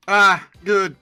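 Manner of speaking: usual speaking voice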